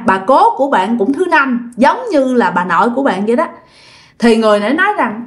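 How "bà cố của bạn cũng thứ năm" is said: ordinary delivery, nothing marked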